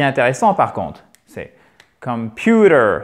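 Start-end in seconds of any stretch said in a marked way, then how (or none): 2.0-3.0 computer drawn out slowly, louder on a long PU; tonic stress demo. Shown as a demonstration. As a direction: lean hard on the middle syllable of computer, then fall. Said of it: "c'est l'accent tonique".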